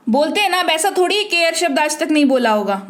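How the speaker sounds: ordinary talk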